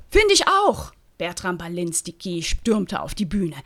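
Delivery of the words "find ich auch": high, loud cry of agreement; loud outburst. Acted. Actor storming the stage, shouting. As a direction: burst in shouting it.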